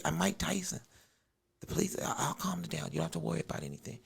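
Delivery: soft, half-whispered calming voice; soothing hush. Retold or imitated. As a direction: hush him gently like a scared kid. Mike calms the gunman.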